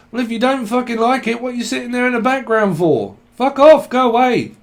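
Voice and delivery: high-pitched snappy dismissal, loud at the end; acted irritation. Reader telling a critical watcher to leave, irritated. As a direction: send them packing, mock annoyed.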